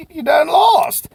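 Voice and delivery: high, loud and slow; preacher-style punch line; slow emphatic warning. Real, not acted. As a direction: lean hard on every word. Warning to salespeople who don't ask.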